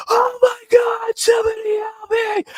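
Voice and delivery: high far-off scream for help, mimicked; imitated screaming. Neighbor screaming for help outside the apartment, high mock scream. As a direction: shriek it high like a stranger outside. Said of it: "Like, screaming, screaming".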